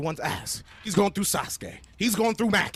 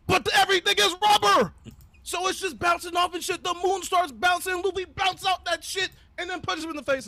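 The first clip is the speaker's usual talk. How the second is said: much higher, shouted finish; excited climax; 11 semitones higher, 3 dB louder than usual. Retold or imitated. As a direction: race to the knockout, shouting with glee. Climax of retold fight.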